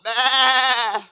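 loud, high bleating bah; hammed-up lamb bleat.

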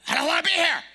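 hammed-up tantrum cry; loud whiny protest. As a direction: wail it like a sulking child. Pouting at God.

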